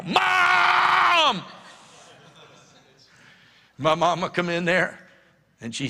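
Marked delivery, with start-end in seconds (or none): 0.0-1.4 put-on high, loud kid yell calling Mom; child shouting for Mom from the kitchen. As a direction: holler for Mom like a tattling kid. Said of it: "And I yell".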